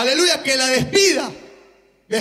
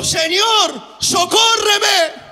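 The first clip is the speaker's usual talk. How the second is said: pleading cry; 5 semitones higher, much slower than usual. Acted. Preacher voicing the woman's plea.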